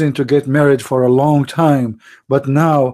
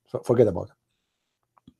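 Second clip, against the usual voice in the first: whisper; 7 semitones lower, 8 dB quieter than usual. Acted. Sarcastic aside after Prophet remark.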